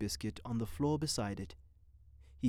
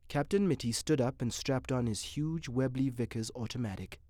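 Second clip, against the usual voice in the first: usual speaking voice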